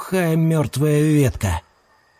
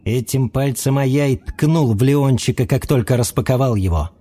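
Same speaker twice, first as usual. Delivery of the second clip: ordinary delivery, nothing marked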